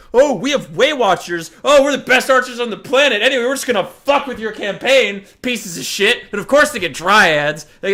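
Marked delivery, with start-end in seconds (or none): 0.1-6.2 louder, mock-arrogant put-on elf; louder smug mock Wood Elves bragging. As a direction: sneer like a snobby elf. Wood Elves voiced smugly.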